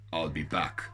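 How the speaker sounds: low, soft action-hero catchphrase voice; Arnie. A narrator's reading. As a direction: drop low for a film tough-guy impression. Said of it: "in an Arnie-type voice".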